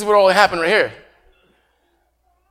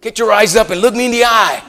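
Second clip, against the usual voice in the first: loud, higher stern command; stern teacher; 5 semitones higher, 7 dB louder than usual. Retold or imitated. Teacher scolding a shy Puerto Rican boy, loud and stern. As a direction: bark it like a strict teacher. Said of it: "the teachers used to tell me".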